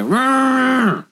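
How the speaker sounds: high mock roar; playful monster growl. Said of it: "Growl at us".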